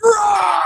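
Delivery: very high shouted roar; officer roaring at the doctor; pained roar. Retold or imitated. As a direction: roar through the pain. Clawing at doctor in pain.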